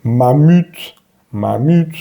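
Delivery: mamut as "ma-müt", rounded ee; careful French-style mamut; French U in mammouth example. Shown as a demonstration. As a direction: draw out mamut with puckered u. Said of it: "También tenemos".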